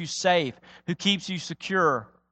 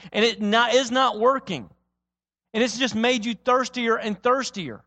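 ordinary talk, nothing marked

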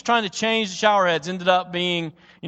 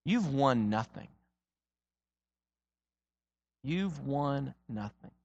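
slow, soft and low; whisper-close, let each word fall gently; gentle, quiet; 4 semitones lower, 8 dB quieter, slower than usual. Acted. Jesus gently telling the athlete he has won nothing, soft and slow. Jesus voiced softly, repeated.

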